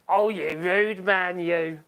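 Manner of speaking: slow, coy teasing voice; hammed-up mock scolding; mock prim scold. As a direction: play the prissy offended lady. Mock scolding after a joke.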